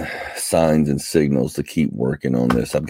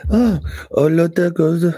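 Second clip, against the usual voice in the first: Disney character voice; 14 semitones higher than usual. Retold or imitated. Spoofing a Beauty and the Beast character, high and bright. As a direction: chirp it like a Disney cartoon character. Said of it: "the candlestick from Pierre".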